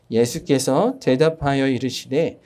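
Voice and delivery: slow, measured Bible reading; scripture reading. Acted. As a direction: read the verse slowly and solemnly.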